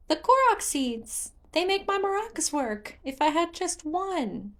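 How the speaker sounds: put-on a child near tears; sad Korok. Hestu explains, voice trails away.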